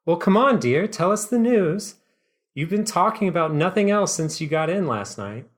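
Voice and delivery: eager, high mom pressing for news. Acted. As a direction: coax it out like a curious mom. Mom voiced in the GM's story.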